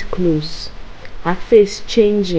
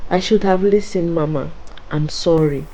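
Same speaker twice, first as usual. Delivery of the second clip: ordinary delivery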